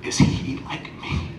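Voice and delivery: whispered through tears, slow; quoting a father's tearful whisper. Retold or imitated. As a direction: barely get the words out as if crying. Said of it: "he starts crying and he says".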